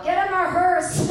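mock yell. Imagined onlooker to rental guy, mock yell. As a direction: yell it out like a jokey order. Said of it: "would have yelled".

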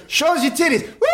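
high emcee shout with a whoop; hype man. Retold or imitated. Emcee to the second woman, hyped. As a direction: call it out and whoop. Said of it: "It's the same thing".

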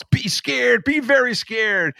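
high-pitched alarmist cry; mock yelling. Opposing party yelling at everybody else, mock yelling. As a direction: cry out a mock warning, high, drawing out the end. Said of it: "yelling at everybody else".

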